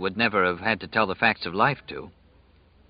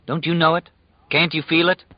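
urgent pleading; 7 semitones higher than usual. A narrator's reading. Ralph insists doom is here.

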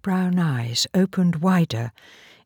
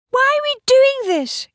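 put-on high, loud little-girl voice; young Polly; 5 dB louder than usual. A narrator's reading. Young Polly questions removing the labels, childlike. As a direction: ask in a piping child's voice. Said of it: "young Polly asked".

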